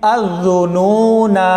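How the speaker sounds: slow recited अज़ुनूना; Quranic recitation. Shown as a demonstration. Teacher presenting the fourth word, slow recitation. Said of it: "चौथा लफ्ज देखें".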